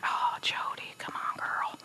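soft breathy whisper, fond and teasing; gentle Jesus. Pretend. Jesus gently teasing Jody, in a soft whisper. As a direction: chide her tenderly under your breath. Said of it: "Jesus is probably like".

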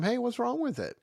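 lightly voiced kindly old man calling over. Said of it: "the old man asks him one more time".

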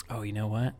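ordinary talk

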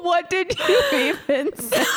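high, squeaky laughing question; asking while cracking up; laughing to tears. Real, not acted. Still laughing at the reference.

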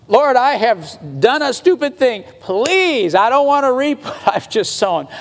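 high, hammed-up begging to God; pleading prayer. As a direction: act out a desperate, sorry plea. Acts out desperate repentant prayer.

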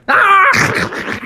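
high, loud biting shriek; baby creature attack cry. Acted. Grogu lets out a high, loud cry as he bites. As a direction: lunge in with a fierce little cry. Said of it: "Grogu went".

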